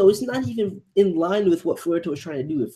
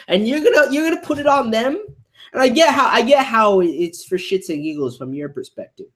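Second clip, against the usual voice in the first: heated outburst; 6 semitones higher, 7 dB louder than usual. Real, not acted. Reactor arguing heatedly with the character.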